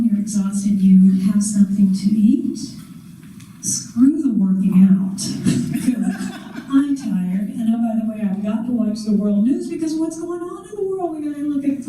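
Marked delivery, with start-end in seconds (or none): 5.2-6.7 a short high laugh, half to herself; laughing at her own excuse; high airy laugh. Real, not acted. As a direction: laugh off skipping the workout. Laughs at skipping workout.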